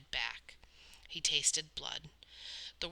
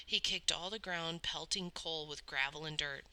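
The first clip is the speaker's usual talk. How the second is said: plain reading voice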